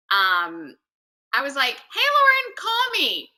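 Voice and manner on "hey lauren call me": high-pitched, giggly call-out; urgent summons. Retold or imitated. Replaying her message to Lauren, high urgent summons. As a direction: call out to a friend in mock urgency.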